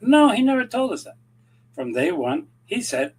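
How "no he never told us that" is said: high, loud insistent no; emphatic no. Real, not acted. Arguing the mayor never claimed capitalism, emphatic no. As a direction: knock the idea down hard.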